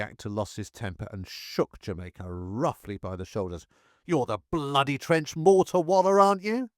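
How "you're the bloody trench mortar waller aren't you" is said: a louder, higher, furious demand; angry officer demanding an answer, fierce and loud. A narrator's reading. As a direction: shake him with the words, losing his temper. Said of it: "he asked fiercely".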